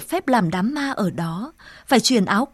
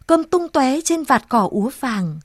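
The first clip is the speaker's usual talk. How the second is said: usual speaking voice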